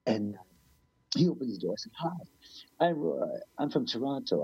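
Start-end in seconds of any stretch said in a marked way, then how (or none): 2.0-4.4 lightly acted eager, higher-pitched greeting; naive newcomer. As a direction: imitate a naive kid saying hello. Naive kid introducing himself at door.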